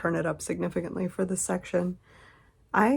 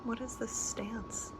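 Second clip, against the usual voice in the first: whispering; 5 semitones higher, 14 dB quieter than usual. Real, not acted. Whispering to pet.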